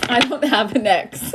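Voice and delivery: said through giggles; laughing. Real, not acted. Reader joking to viewers, giggling through it. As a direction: laugh the words out.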